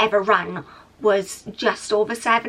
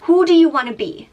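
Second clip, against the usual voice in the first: self pep talk; 4 semitones higher, 6 dB louder than usual. Retold or imitated. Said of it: "started saying to myself".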